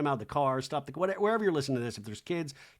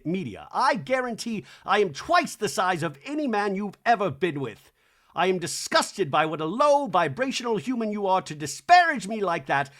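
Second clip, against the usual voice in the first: loud, raised-pitch mock tirade; angry rant; 5 semitones higher, 5 dB louder than usual. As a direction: read out an outraged message, punching the insults. Acting out Jared's furious message.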